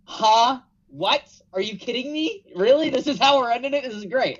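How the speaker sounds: high, baffled crowd reaction; confused crowd. Retold or imitated. Describing the crowd at the ladder finish, high baffled disbelief. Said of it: "the crowd was just like".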